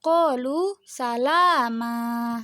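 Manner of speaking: slow recitation, long vowels in QALU SALAMA; bacaan mad iwad. Shown as a demonstration. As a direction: draw out "qalu salama" to an open ending. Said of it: "jadi dibaca". (QALU SALAMA dibaca pelan dan panjang tanpa n)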